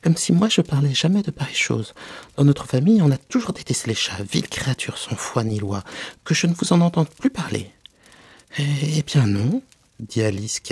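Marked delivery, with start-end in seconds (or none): 0.0-7.7 high, loud offended tirade; indignant rebuke. Acted. The mouse forbids Alice to mention cats, indignantly scolding. 8.6-9.6 higher, slow, stammered agreement; hesitant yielding. Acted. Alice backs down before the angry mouse, hesitant. Alice hurries to change the subject.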